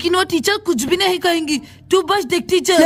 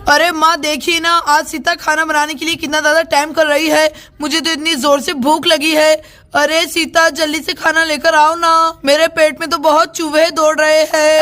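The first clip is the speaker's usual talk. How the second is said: whining hungry husband; 3 dB louder than usual.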